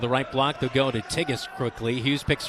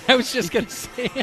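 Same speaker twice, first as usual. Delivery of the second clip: real laughter through the words, pitched high; high, laughing interjection; 9 semitones higher, 3 dB louder than usual. Real, not acted. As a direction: crack up while agreeing. Cracking up at the pronunciation joke.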